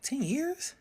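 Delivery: high, rising disbelief; retold stunned question; incredulous echo. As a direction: repeat it back high-pitched, in disbelief. Shocked reply to the answer.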